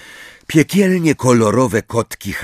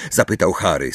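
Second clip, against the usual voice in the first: plain reading voice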